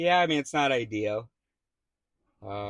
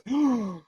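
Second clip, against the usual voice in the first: mock shocked gasp; 12 semitones higher than usual. Mock outrage of dog owner.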